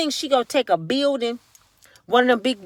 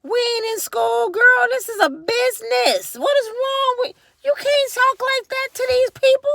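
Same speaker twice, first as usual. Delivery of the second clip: much higher scolding lecture; scolding prim voice; 12 semitones higher than usual. Retold or imitated. Scolding the mayor like an exasperated aide, high. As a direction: lecture her, pitched way up. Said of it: "You like".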